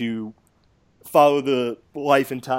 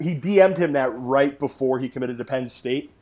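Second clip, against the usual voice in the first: ordinary talk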